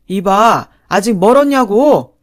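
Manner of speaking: loud, impatient father-in-law outburst; sudden angry yell. A narrator's reading. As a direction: yell for it impatiently, rising in pitch. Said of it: "시아버지가 버럭 소리를 질렀죠".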